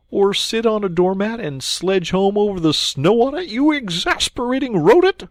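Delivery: mock Rat's silly dance; sarcastic Mole. Mole sarcastically scolding Rat over doormat.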